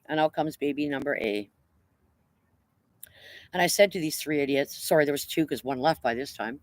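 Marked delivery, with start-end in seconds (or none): none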